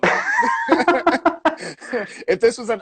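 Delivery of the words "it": laughing. Real, not acted. Laughing delight.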